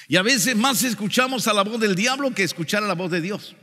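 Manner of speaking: insistent pulpit delivery, high and forceful; fervent preaching. Real, not acted. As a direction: press the warning on the congregation.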